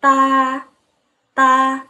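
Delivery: "Ta" on a high level tone; sustained high flat "Ta", unaspirated; first tone. Shown as a demonstration. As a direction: hold "Ta" long, high and even. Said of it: "D A nada satu".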